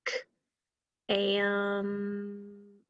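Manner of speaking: "k" alone, then a long soft "am" and a separate p; stretched-out sounds. Shown as a demonstration. Teacher helping pupils count sounds, stretched out. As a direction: draw out "k, am" sound by sound. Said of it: "let's stretch it out".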